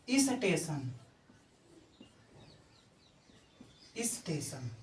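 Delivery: स्टेशन said with a faint i before it, is-teshan; is-teshan, small vowel before the st; light i- prefix. Shown as a demonstration. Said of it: "कोई बोलता है". (इस्टेशन जैसा उच्चारण)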